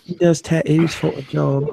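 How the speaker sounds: bad mock English accent; unidentifiable put-on English accent. Host telling what the English friend does, fake English accent. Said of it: "I don't know what accent that was".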